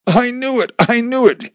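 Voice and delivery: high, strained cry of I told you so; near tears. A narrator's reading. Wife scolding husband after danger, near tears. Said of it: "Amy was on the verge of tears".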